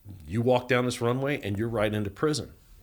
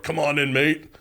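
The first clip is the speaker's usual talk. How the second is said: louder, higher imitation of a commanding officer; gruff order imitated; 5 semitones higher, 5 dB louder than usual. SRT team to prisoner, imitated loud order. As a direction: imitate a tough team hauling someone off.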